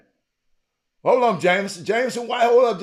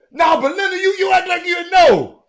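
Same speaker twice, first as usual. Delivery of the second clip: teasing shout; 11 semitones higher, 5 dB louder than usual. Real, not acted. Teasing a viewer.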